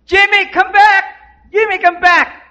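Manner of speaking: put-on high-pitched urgent yell to a child; screaming mother. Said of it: "she's screaming".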